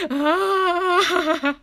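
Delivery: high, loud, overwhelmed wail; retold near-tears cry. Fan thanked by the staff member, mock tearful wail. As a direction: wail as if about to cry with joy. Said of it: "on the verge of tears again".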